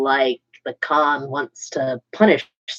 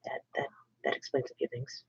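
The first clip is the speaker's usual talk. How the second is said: whispering; 3 semitones lower, 17 dB quieter, faster than usual. Real, not acted. Aside about a psionic implant.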